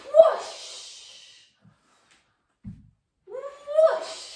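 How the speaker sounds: high breathy whoosh of flame; fire whoosh. Shown as a demonstration. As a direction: blow out dragon fire. Dragon fire sound effect.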